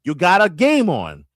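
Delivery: normal talking voice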